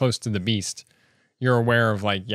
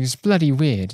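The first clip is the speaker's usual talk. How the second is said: imitated South London British accent; 4 semitones higher, 4 dB louder than usual. Recalling grandma's British speech, South London accent. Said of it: "they're all South Londoners".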